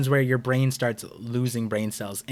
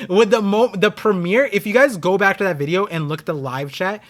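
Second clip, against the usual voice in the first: usual speaking voice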